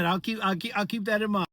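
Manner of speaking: ordinary delivery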